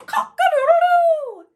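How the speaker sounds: very high, loud cock-a-doodle-doo; rooster crow. Acted. The startled fowl cries out, loud high crow. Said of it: "who cried".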